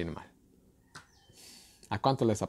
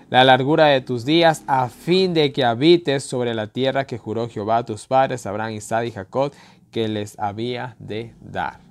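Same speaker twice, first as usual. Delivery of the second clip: scripture reading; 8 dB louder than usual. Acted. Preacher reading the promise to the fathers, solemn. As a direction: proclaim the promised land.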